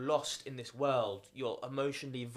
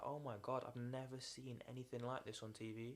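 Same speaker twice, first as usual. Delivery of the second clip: softer, breathless amazement; hushed awe; 11 dB quieter than usual. Retold or imitated. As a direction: marvel at it quietly, half to yourself. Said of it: "I was like".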